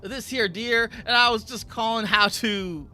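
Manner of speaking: put-on Southern US accent, lifted and loud. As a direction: play the Southern caller on the phone. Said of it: "if I can even do a southern voice".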